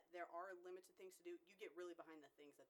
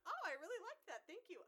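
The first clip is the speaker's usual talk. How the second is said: much higher and louder gushing thanks; delighted; 13 semitones higher, 8 dB louder than usual. Retold or imitated. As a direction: gush like the rap made her day. Thrilled reply to the cashier.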